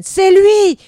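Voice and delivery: screamed high and very loud; a hateful shriek of accusation; the shaman's furious shout; cry of hate. Acted. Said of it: "un cri de haine".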